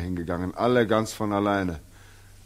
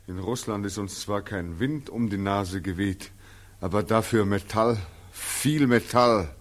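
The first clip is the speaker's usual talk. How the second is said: ordinary talk, nothing marked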